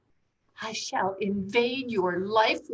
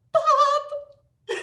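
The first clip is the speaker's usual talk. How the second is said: much higher, thrilled cry; delighted squeal; 5 dB louder than usual. Acted. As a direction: squeal his name with glee. Thrilled squeal then laugh.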